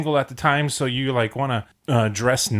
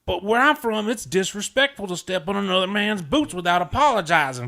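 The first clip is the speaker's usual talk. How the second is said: high, put-on Southern pride; imitating the proud friend; 9 semitones higher than usual. Retelling his friend's boot speech, mock Southern accent. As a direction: play up his hurt honor over boots. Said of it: "And he's like".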